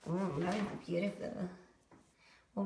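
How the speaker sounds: ordinary talk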